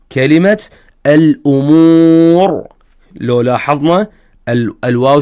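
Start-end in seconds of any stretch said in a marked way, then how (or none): 1.1-2.7 slow "الأمور" with a long oo and heavy final R; pausal tafkhim. Shown as a demonstration. Teacher giving an example of stopped ra, drawn-out demonstration. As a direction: stretch "الأمور", stopping on a thick vowelless R. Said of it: "الراء وقفنا عليها".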